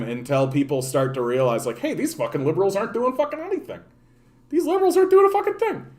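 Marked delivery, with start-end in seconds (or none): 1.8-5.9 rising from higher to far higher, faster, mounting anger; building mock outrage. Voicing fed-up people ranting at liberals, building outrage. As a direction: ramp up the fury of fed-up people.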